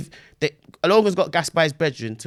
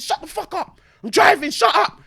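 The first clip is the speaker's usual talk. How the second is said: higher, sharp angry shout; angry snap; 10 semitones higher, 5 dB louder than usual. Retold or imitated. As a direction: tell her to shut up while driving. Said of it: "Logan said".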